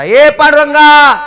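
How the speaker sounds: loud, high, drawn-out call. Shown as a demonstration. Demonstrating how parents summon a child, calling out. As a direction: call out slowly to a child, full voice. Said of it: "हाक मारा".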